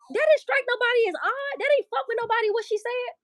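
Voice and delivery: very high-pitched, scandalized disbelief; incredulous indignation. Real, not acted. Asking viewers why nobody found her words odd, incredulous indignation. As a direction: question it in a high, appalled voice.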